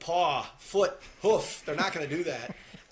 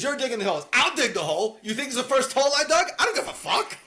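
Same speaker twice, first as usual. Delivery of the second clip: angry Jimmy quote; 7 semitones higher, 5 dB louder than usual. Retold or imitated. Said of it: "He says, he says".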